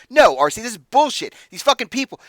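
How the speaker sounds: loud, high-pitched angry protest; getting worked up. Acted. Hothead protesting to his boss, worked up and loud. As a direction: protest hotly to the boss, voice climbing. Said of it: "he's starting to get worked up".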